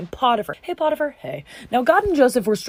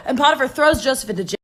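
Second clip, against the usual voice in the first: ordinary talk, nothing marked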